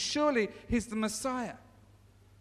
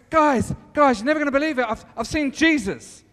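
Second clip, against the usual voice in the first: louder, breathless announcement; excited; 4 semitones higher, 8 dB louder than usual. Acted. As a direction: burst in with the big news. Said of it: "So I'm going".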